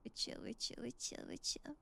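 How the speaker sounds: hushed repeated reassurance; soothing whisper. Real, not acted. Sneaking past creatures, whispering to keep them calm.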